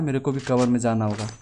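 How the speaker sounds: ordinary delivery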